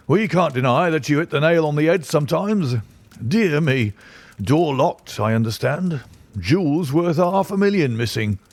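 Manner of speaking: high, blustering police inspector; bluff, self-satisfied inspector; pompous inspector. A narrator's reading. Athelney Jones speaking, pompous.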